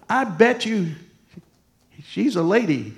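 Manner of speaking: loud, high imitation of a stubborn woman; insistent challenge. Wedding guest betting against the preacher, loudly insistent. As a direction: dig in, mimicking her loudly.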